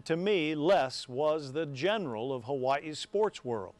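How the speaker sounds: normal talking voice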